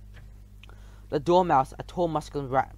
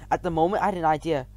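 ordinary delivery

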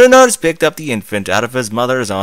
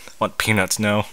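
usual speaking voice

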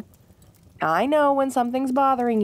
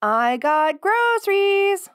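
high, slow call from the front door; 12 semitones higher, 3 dB louder, much slower than usual. Acted. Arriving home, calling to partner in another room, drawn-out call. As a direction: sing it out across the house to her partner.

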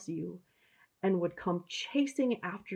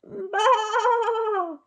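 high, loud lamb bleat; frightened lamb calling out high; lamb bleating loudly; 14 semitones higher, 7 dB louder than usual. Acted. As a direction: bleat out like a lost little lamb. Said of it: "he heard in the distance".

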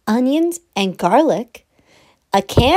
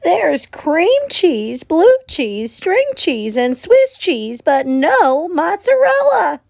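high, slow fussy hen; a hen grumbling over her fridge; 6 semitones higher, slower than usual. Acted. Hen lists the cheeses.

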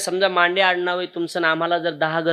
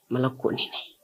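broken-hearted murmur; 3 semitones lower, 8 dB quieter than usual. Retold or imitated. Boy lists his lost family.

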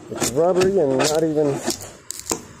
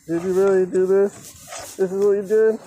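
higher, louder exasperated challenge at absent workers; real annoyed outburst; indignant rant; 8 semitones higher, 4 dB louder than usual. Real, not acted. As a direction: call them out in disbelief. Ranting at previous repair crew.

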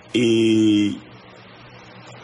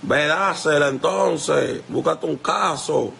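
mocking retort; 5 semitones higher than usual. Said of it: "le dije".

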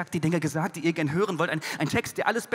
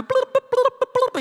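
very high, loud bubbling fish voice; the swordfish answers in bubbles; 9 dB louder, slower than usual. Retold or imitated. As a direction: squeak it like a fish underwater. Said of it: "er meinte".

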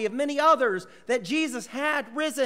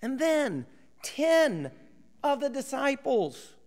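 usual speaking voice

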